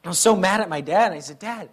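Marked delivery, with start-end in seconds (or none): 1.4-1.7 put-on hurt child. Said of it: "I started crying".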